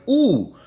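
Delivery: high-pitched cooing "ooh"; re-enacted childlike "ooh" of wonder; delighted ooh. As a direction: coo it high, as if dazzled. Retold delight at dolls.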